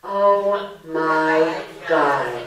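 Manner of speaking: a very slow, loud, dragged-out "Oh my God."; weary groan. Retold or imitated. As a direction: moan as if the miserable stories wore you out. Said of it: "we are all going".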